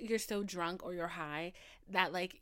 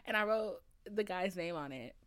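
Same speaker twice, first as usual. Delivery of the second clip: ordinary talk, nothing marked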